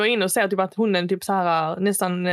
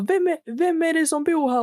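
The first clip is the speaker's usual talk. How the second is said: trying to shout; 8 semitones higher than usual. Retold or imitated. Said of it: "försöker han skrika".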